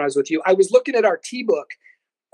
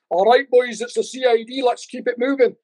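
higher-pitched put-on agent voice; bluffing federal agent; 5 semitones higher than usual. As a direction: bluff your way through as an official. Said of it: "I said, "Tuck your badge." I tucked my badge".

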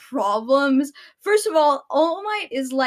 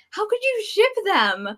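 incredulous; 8 semitones higher than usual. Real, not acted. Ranting about fan ships.

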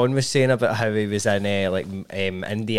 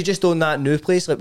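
normal talking voice

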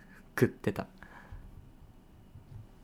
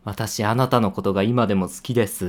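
loud teasing proxy confession; 7 dB louder than usual. Acted. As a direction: announce it loudly for everyone, mocking. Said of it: "でっかい声で言えって言うからさ". (大声でからかうように代弁)

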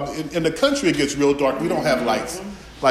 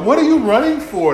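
clueless horror-movie victim; 9 semitones higher, 4 dB louder than usual. Retold or imitated. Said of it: "talking about".

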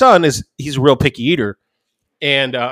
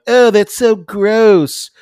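high, slow, drawn-out complaint; imitated kids' disgust; 7 semitones higher, much slower than usual. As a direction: mimic grossed-out kids at dinner. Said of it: "they typically are like".

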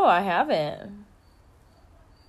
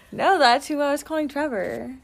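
ordinary talk

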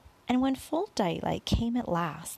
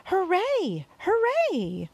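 high, loud hooray; cheering; 11 semitones higher, 5 dB louder, much slower than usual. A narrator's reading. Creatures cheering from the edge of the peach. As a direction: cheer with joy.

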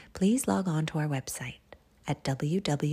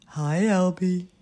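loud animal character; big, loud friendly greeting; hippo voice; 2 semitones higher, 8 dB louder than usual. Acted. Hippos finding lost Albie, loud character voice.

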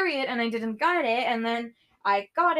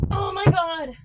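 alarmed gasp; 8 semitones higher than usual. Real, not acted. Vlogger watching something fall, alarmed. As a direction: cry out in alarm. Someone falling.

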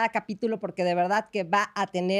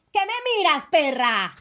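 villana desafiante; 3 semitones higher, 3 dB louder than usual. Retold or imitated. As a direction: mimic a telenovela villain's insult. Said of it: "me encanta cuando dices". (villana retadora, imitada)

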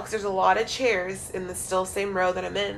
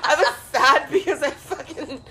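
laughing, louder; 5 semitones higher, 6 dB louder than usual. Real, not acted. Admitting her real reason to her co-host while laughing. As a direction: laugh through the confession.

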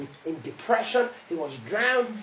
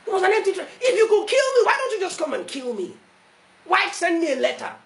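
high, loud taunting challenge; impassioned preacher's retort; preaching; 12 semitones higher, 6 dB louder than usual. Acted. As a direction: throw the dare back at the enemy.

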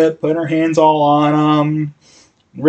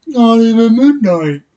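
high, loud and slow protest; mildly hammed-up dismay; mock outburst; 8 semitones higher, 6 dB louder than usual. Partner complains at the bar, high, loud and slow. Bar fight about to start.

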